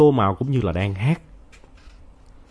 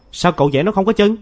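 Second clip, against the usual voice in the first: put-on raised, higher child's voice; louder; 9 semitones higher, 5 dB louder than usual. A narrator's reading. Mai answers Nam about the bird, raising her voice. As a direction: push back louder and higher. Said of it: "Mai nói lớn hơn".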